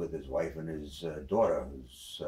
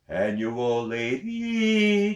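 drawn-out, very slow distant echo; 11 semitones higher, 9 dB louder, much slower than usual. Acted. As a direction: sing it back like a mountain echo. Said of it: "he hears ... coming back".

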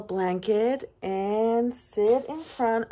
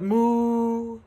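long sustained moo, raised pitch; cow's moo; 4 semitones higher, 3 dB louder than usual. A narrator's reading. Cow greeting the little truck, long playful moo. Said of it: "Cow said".

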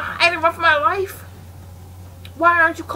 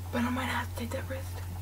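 soft unvoiced whisper; 3 semitones lower, 16 dB quieter than usual. Real, not acted. Weighing a risky move while hiding, whisper. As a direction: breathe it out quietly.

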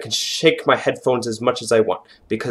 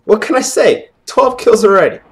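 raised, cocky victory call; excited boasting; 7 semitones higher, 4 dB louder than usual. Real, not acted. Gamer boasting of twelve kills, excited. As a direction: brag it out in a higher voice. Boasting after winning fight.

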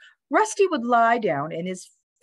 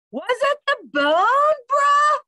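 high, loud, desperate dude shout; hollering; 6 dB louder, slower than usual. A narrator's reading. Dog demands the story's ending from bird, hollering. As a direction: holler the question at full stretch. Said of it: "Rocket hollered".

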